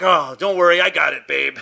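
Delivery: loud, swaggering reassurance; cocky boyfriend. Retold or imitated. Boyfriend shows off to girlfriend over snake, cocky. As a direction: puff it up with mock bravado.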